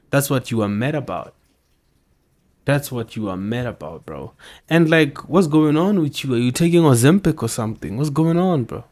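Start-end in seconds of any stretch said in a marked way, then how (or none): 4.7-8.8 higher and louder, pressing him; loud disbelief. Real, not acted. Vlogger pressing the politician on screen, loud disbelief. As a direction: demand an answer, incredulous.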